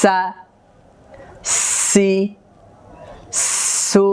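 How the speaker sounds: long hissed s leading into সা, সি, সু; breath before vowel. Shown as a demonstration. Teacher showing hams before the vowel, hissed onset on সা, সি, সু. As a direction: sustain the sharp s, then open briefly into the vowel of সা, সি, সু. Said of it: "হামসের আওয়াজটা হরকত উচ্চারণ করার আগেই হবে".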